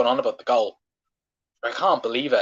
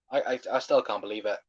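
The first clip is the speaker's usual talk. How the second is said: low, much softer, muttered disbelief; deflated disbelief; 6 semitones lower, 8 dB quieter than usual. Real, not acted. Fan deflated after the goal is ruled out, soft low disbelief. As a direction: sink into quiet disbelief.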